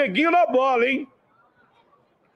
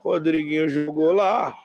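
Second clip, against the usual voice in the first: play-by-play commentary; 4 semitones lower than usual. Acted. Live match commentary.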